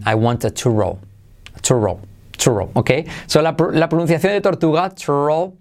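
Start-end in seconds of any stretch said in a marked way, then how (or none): 0.1-2.7 put-on English accent. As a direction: order a "churro" as an Englishman would. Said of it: "como un inglés que habla español mal". ("churro" con acento inglés, más grave)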